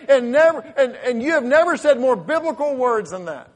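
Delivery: loud, high, pleading sermon delivery; full-force preaching. Acted. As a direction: cry out the struggler's helplessness like a fervent preacher.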